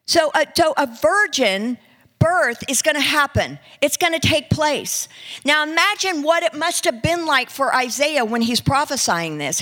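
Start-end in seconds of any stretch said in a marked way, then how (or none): none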